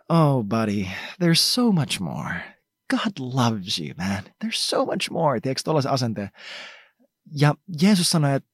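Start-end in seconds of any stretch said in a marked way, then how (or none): 0.1-5.4 lightly voiced kind friend, unhurried; a hint of a buddy encouraging him; Jesus gently. Jesus kindly tells the young man there's more. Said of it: "mä luen nyt tohon tarinaan Jeesuksen luonnetta silleen".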